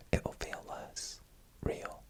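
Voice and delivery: plain reading voice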